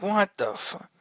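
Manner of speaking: high, hushed mock shock; retold disbelief. Man reacting to a neighbor's blunt disclosure, retold disbelief.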